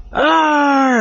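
high, drawn-out mock pirate cry; a boy's playful pirate roar back; pirate arr. Boy answers pirate's roar.

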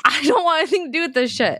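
fast, laughing brush-off; laughing refusal. Real, not acted. Refusing the demon tooth, laughing fast. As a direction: laugh it away quickly.

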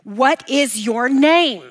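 slow, measured demand as Jesus; commanding question. Acted. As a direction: press each word with authority. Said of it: "Now Jesus is one-upping him".

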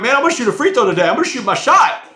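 high, fast, voice cracking with nerves; squeaky cracking voice. Retold or imitated. Nervous new inmate squeaking back at the crowd, high and fast. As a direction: squeak it out like a scared kid. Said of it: "my voice is squeaking and cracking".

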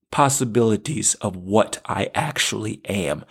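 usual speaking voice

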